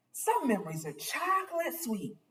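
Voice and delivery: ordinary delivery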